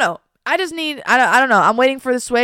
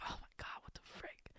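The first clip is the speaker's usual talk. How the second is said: whisper; 30 dB quieter than usual. Retold or imitated. Inner panic quoted, overthinking.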